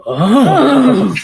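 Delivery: drawn-out high "oh"; long, swooping mock-moved "oh"; imitated reacting cop. Host imitating cop hearing that's his fiance, drawn-out "oh". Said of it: "he, like, goes".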